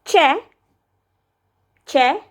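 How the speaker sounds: crisp "che" like church; che sound. Shown as a demonstration. Vlogger shows Turkish ç: crisp "che". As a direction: say "che" sharp and clear. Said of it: "with this".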